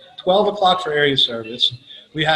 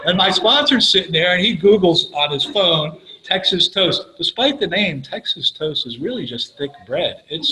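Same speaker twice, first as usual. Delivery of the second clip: ordinary talk, nothing marked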